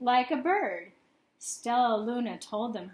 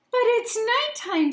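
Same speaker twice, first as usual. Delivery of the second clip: high, louder squeak; squeaky protest; 12 semitones higher, 8 dB louder than usual. A narrator's reading. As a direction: squeak it like a worried little bat. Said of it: "Stella Luna squeaked".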